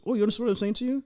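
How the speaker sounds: a mock-surprised high question from the girlfriend. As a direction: lift it up in playful disbelief. Girlfriend surprised he understands Russian.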